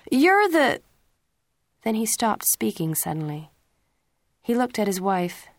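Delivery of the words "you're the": high, loud angry start, broken off; angry outburst, cut off. A narrator's reading. Father losing his temper at his son, loud angry shout cut short. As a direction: shout and stop dead.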